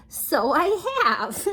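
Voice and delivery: delighted king. Acted. King discovering he can laugh.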